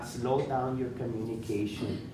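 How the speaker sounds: normal talking voice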